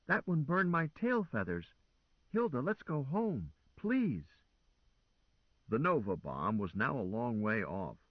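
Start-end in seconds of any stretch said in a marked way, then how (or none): 0.1-4.3 high pleading machine voice; pleading car. A narrator's reading. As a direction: whine to go home like a scared machine. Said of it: "the car moaned".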